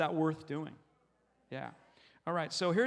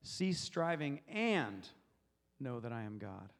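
slow build to a drawn-out "and"; stress on and; 5 semitones lower, slower than usual. Shown as a demonstration. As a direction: hit AND hard, pause, then drop away. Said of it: "I'm gonna go to the next word".

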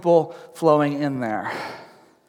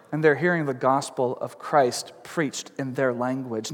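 usual speaking voice

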